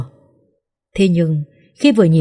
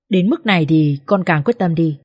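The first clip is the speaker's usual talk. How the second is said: plain reading voice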